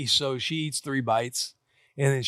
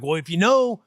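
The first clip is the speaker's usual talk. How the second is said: higher, louder and fast retort; exasperated dad; 6 semitones higher, 6 dB louder than usual. Retold or imitated. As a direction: fire back fast at a stubborn kid. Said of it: "I'm like".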